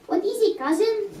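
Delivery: high-pitched put-on Spanish accent. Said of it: "he said in a Spanish accent".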